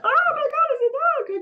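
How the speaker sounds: very high, loud panicked yelp; mock terror at a dog; panicked squeal. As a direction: shriek it out like a scared child. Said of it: "and it's like".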